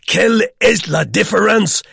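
loud, high, boisterous French; hilarious questioning. A narrator's reading. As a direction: fire off merry questions in French at full voice. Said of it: "interjected Herr Vogel hilariously".

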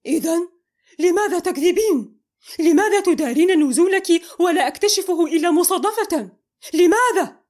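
loud, high, relentless; shouting. A narrator's reading. Mohamed shouting at Amal about her lies. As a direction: yell each why harder. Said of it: "صرخ فيها".

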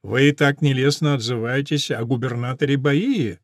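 ordinary delivery, nothing marked

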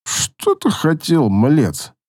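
slow, slurring, tongue-tied; a drunk elf slurring; slurred drunk. Acted. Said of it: "Заплетающимся языком пробубнил эльф".

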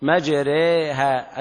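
مجريها drawn out very slowly, ra leaned toward e, mad͡ʒereha; مجريها بالإمالة الكبرى ببطء; imala demo. Shown as a demonstration. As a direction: stretch مجريها and bend the alif toward e. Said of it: "إذا قرأت كلمة سيبويه".

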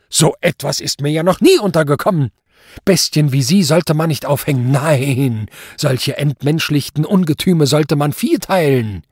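loud, high tirade from the bench; raging judge at full volume; bellowing rage. A narrator's reading. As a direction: rant in furious condemnation. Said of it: "brüllte er deshalb los".

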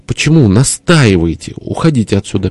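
ordinary delivery, nothing marked

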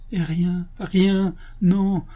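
high, broken-up words of despair. Acted. Canon telling the abbot nothing stirs appetite, despairing. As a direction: insist hopelessly, pitched high.

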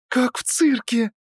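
high, slow, delighted voice; amazed young woman. Acted. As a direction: marvel like at a circus.

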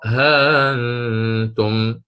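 ها أنتم drawn out slowly with eased hamza; tajweed recitation of ها أنتم; slow Quranic recitation. Shown as a demonstration. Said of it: "الإمام قالون يقرأ".

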